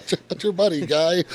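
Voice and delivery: high, swapped mock Canadian retort. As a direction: flip the words and yap them high. Swapped version of the Canadian line.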